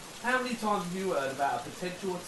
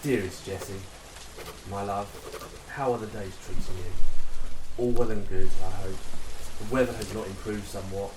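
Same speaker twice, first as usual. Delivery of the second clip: reading a love letter; 8 semitones lower, 6 dB louder than usual. Acted. Soldier reading his letter home to his sweetheart, tender. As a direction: read it to her like you miss her.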